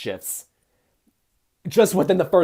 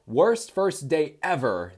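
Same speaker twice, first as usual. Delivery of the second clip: slow, higher complaint, weight on "ever"; emphasis on EVER; 5 semitones higher, slower than usual. A narrator's reading. Reading the individual's complaint, emphasis on "ever". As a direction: draw out the line and lean on "ever". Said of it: "putting emphasis on that word".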